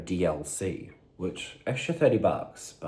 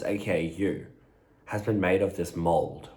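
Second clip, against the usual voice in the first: normal talking voice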